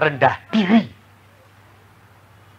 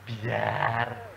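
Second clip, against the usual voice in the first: soft resigned; 7 semitones lower, 13 dB quieter than usual. Retold or imitated. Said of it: "dimaki-maki suami".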